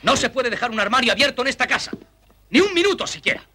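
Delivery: high, agitated grumbling; indignant complaint. Acted. Don Ventura complaining about the open cupboard, indignant rant. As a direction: rant with pitch jumping up in outrage.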